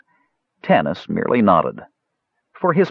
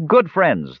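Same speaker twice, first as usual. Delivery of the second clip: "Good friends" said high with firm weight; emphatic; 8 semitones higher than usual. A narrator's reading. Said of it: "the funny man said emphatically".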